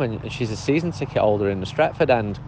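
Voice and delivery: normal talking voice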